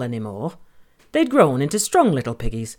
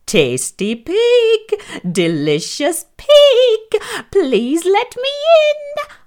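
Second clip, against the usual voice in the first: a lightly voiced wolf, slow and coaxing; werewolf whisper; 6 dB louder, much slower than usual. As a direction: lure the pig in with a sly, drawn-out whisper. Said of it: "whispered the wolf".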